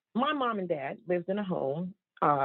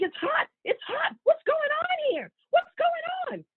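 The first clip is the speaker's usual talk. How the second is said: very high squealing mock panic; panicked yelling. Mom feeling her pants burn, squealing in panic. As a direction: imitate mom shrieking in alarm. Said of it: "My mom started yelling and screaming".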